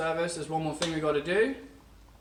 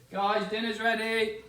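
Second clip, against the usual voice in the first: raised, carrying call to dinner; 6 semitones higher, 6 dB louder than usual. Real, not acted. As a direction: call out across the house. Calling family to dinner.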